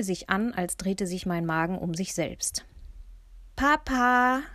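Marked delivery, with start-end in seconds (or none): 3.5-4.4 hoch und laut gerufen; rufend. A narrator's reading. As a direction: ruf nach dem Vater. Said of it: "rief Benno". (Benno ruft laut nach Papa auf dem Sofa)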